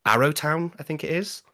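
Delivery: normal talking voice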